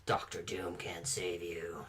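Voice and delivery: slow, low, unvoiced menace; creepy whisper. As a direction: whisper it like a horror villain. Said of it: "Just do the whole show like that".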